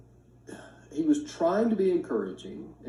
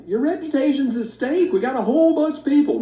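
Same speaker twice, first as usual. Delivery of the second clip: much higher and louder, a bit fast; rally God like a coach before the game; fired-up mock ultimatum; urgent challenge; 13 semitones higher, 4 dB louder than usual. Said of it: "it's like".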